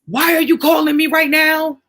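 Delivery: high, loud irritated snap; picking up the phone annoyed, high and loud. Retold or imitated. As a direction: bite back at the caller, put out. Mocking an annoyed answer.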